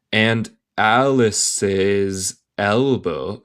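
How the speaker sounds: drawn-out, measured words; slow careful reading. Shown as a demonstration. English teacher reading a story to learners, very slowly.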